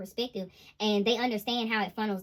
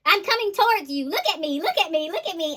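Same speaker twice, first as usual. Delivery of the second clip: higher, louder, insistent look at me; mock needy lover; 7 semitones higher, 6 dB louder than usual. King of Cups demanding the feminine's attention, loud and needy. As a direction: beg for attention in a hammed-up voice. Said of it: "they're saying, like".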